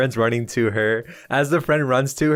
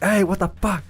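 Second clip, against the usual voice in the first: much higher, indignant telling-off; angry scolding; 9 semitones higher, faster than usual. Acted. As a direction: snap at him to knock it off. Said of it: "Lavolette's like".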